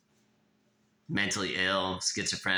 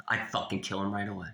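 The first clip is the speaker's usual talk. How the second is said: ordinary delivery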